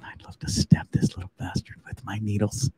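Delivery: whispered mock menace; mum's muttered threat. Knitting mum grumbling about her son, mock whisper. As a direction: whisper it low, like a mum plotting. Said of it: "She's going".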